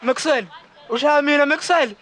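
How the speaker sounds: loud, rising shout. Acted. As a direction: yell as the fight starts. Fight breaks out.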